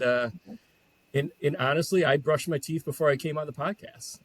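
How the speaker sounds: normal talking voice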